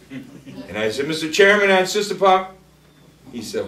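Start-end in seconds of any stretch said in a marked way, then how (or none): 1.1-2.5 loud, high appeal to the chair; re-enacted insistent objection. Re-enacts renewed objection, cut off.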